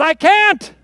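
high, loud cry of giving up; exasperated admission. Retold or imitated. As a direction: throw it out loudly, at the end of your rope. Retelling his realization of defeat.

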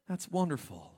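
ordinary talk, nothing marked

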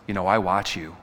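low, creepy stalker voice. Retold or imitated. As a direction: lean in low and unsettling. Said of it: "he goes".

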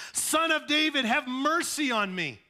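loud, high desperate plea; crying out. Retold or imitated. Blind beggar crying out to Jesus, loud and high. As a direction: cry out to Jesus with everything. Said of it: "insistently cried out".

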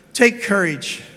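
ordinary delivery

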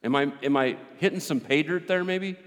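ordinary talk, nothing marked

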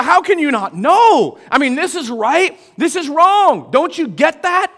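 loud, high mock disbelief; incredulous scolding. Seasoned believers scolding a newcomer.

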